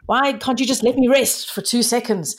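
high-pitched exasperated plea; triggered outburst. Acted. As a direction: play a fed-up partner snapping back. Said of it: "But you're being triggered".

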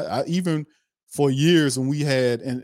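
ordinary delivery

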